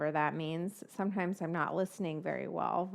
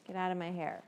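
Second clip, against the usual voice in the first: normal talking voice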